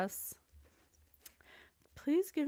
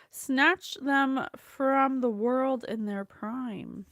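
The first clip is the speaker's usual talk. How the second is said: slow, weighty and louder; slow prayer reading; 3 semitones higher, 6 dB louder, slower than usual. Acted. Reading the psalm's judgment line, slow weighty prayer. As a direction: dwell on every word.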